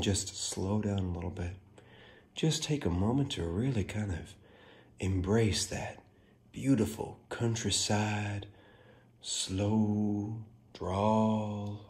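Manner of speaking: soft, lingering countryside drawl; slow drawl. Pretend. Host pausing with everybody after talking too fast, put-on slow drawl. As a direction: ease right down and stretch the words out softly. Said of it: "I feel like I'm talking too fast tonight".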